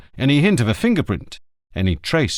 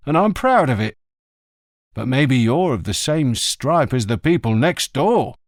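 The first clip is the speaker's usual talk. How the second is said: higher, sardonic and prickly; proud old greengrocer; 4 semitones higher than usual. A narrator's reading. Greengrocer telling the inspector his name is Lenin, proudly. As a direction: needle the cop, then stand proud. Greengrocer mocks police claim.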